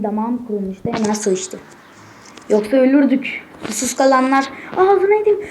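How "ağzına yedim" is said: high, loud gamer yelp at losing; frustrated yell. Real, not acted. Gamer yells at his own failed jump, high and loud. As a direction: blurt it out as you get hit.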